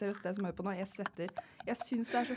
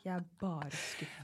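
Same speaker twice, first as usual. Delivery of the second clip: disappointed dad; 7 dB quieter, slower than usual. Retold or imitated. (lav, rolig og sakte skuffet pappa)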